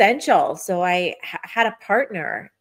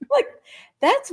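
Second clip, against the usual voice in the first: laughing. Real, not acted. Laughs at own story.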